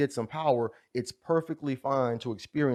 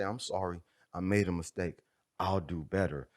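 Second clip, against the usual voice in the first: soft apology; 6 semitones lower, 5 dB quieter than usual. Pretend. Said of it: "I'm gonna stand up and say".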